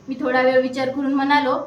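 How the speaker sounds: ordinary delivery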